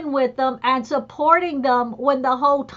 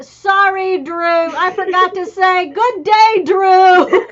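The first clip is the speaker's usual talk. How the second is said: louder, sing-song afterthought; mock apologetic politeness; 3 semitones higher, 5 dB louder, slower than usual. Adding a forgotten greeting to Drew, bright and sing-song. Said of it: "I'm like".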